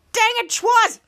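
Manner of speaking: high mock yell of annoyance; acted-out angry outburst; exasperated shout. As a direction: yell at the prankster. Acted victim shouting at prankster.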